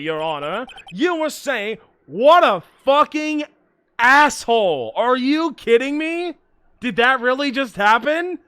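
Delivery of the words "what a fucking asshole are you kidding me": loud, slow angry outburst; furious. Real, not acted. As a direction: spit out the insult. Angry after being hung up on.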